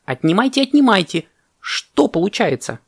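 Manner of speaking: high, insistent egging-on; urging on. A narrator's reading. Parrot presses elephant to take bananas, urging. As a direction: push them on, then draw out the question.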